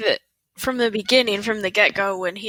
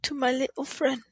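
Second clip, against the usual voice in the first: a Scarface line coming out vaguely British; accidentally British; 3 semitones higher than usual. Retold or imitated. Cohost tries the line, lands in a British accent. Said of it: "That sounded more British".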